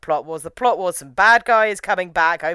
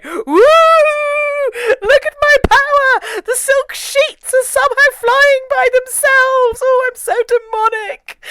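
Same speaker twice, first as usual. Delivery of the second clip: much higher, louder mock demonic glee; hammed-up demon; 7 dB louder, slower than usual. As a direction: crow like a cheesy demon. Mocking the demon's cheesy power.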